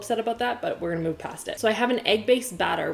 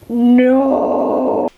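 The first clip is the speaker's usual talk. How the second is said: loud, high cry of dismay; 5 semitones higher, 11 dB louder than usual. Real, not acted. Finding the burnt pizza bottom, a loud dismayed no.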